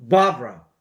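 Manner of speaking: 바브라 from the belly, first syllable stressed; belly-voiced English Barbara. Shown as a demonstration. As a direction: push 바브라 from the diaphragm. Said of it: "배로 소리를 내니까".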